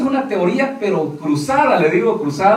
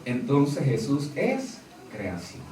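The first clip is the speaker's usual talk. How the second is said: low and much softer, voicing their reasoning; quiet aside; 9 semitones lower, 13 dB quieter, slower than usual. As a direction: drop low and soft as if reasoning for them. Voicing the other side's logic. (en voz baja, como razonando por ellos)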